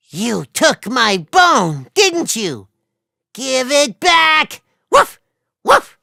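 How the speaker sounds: loud, high barks, demanding it back; dog angrily demands its bone back from the reflection; angry dog. Acted. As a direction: bark furiously at the thief. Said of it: "He was so angry".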